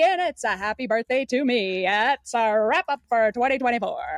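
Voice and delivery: fast, high, loud auctioneer patter. Pretend. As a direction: rattle it off like an auction sale. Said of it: "read the rest of our twenty twenty-four schedule in the style of an auctioneer".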